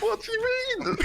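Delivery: very high squeal through laughter; shriek-laugh the question in disbelief; helpless laughter. Real, not acted. Hearing that a friend purred, squealing with laughter.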